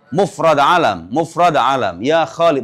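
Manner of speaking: usual speaking voice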